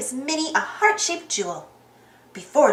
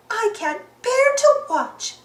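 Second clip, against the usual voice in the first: high, slow, dramatic character voice; high-pitched, drawn-out dismay; lightly voiced Daisy; 9 semitones higher, slower than usual. A narrator's reading. Daisy voiced in read story.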